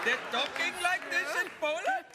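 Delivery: put-on high jokey Indian accent; mock Indian accent. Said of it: "I like your Indian sort of creeping in there".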